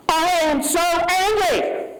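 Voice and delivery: voicing real anger to the congregation; angry. Real, not acted. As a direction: let the anger break through high. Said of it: "Here are my human feelings right now".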